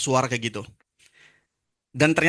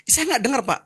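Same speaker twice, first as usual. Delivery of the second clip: high-pitched plea; 11 semitones higher than usual. Retold or imitated. Troubled man answers the pastor.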